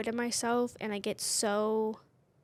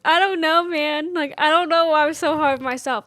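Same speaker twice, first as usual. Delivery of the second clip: tearful, higher and louder, voice breaking; crying; 5 semitones higher, 9 dB louder than usual. Real, not acted. Telling her store director why she's hard on herself, really crying. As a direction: let the tears come through the words. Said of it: "oh my God, I am crying".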